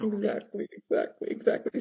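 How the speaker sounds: fading to a whisper. Real, not acted.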